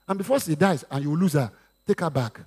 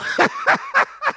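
a real burst of high laughter; loud high laugh; 3 dB louder than usual. Real, not acted. Laughs at his own story.

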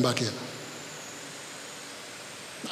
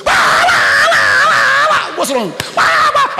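high shrieking wah wah squeals; pig squeals; 9 dB louder, slower than usual. Retold or imitated. Preacher imitating the herd's squeals for his congregation, shrieked very high. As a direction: shriek like terrified pigs.

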